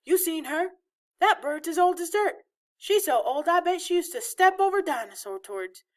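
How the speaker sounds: put-on high, incredulous teenage wisecracker; scoffing boy. A narrator's reading. Older brother mocking grandmother to younger one, scoffing. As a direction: pile on the exaggeration in a high voice.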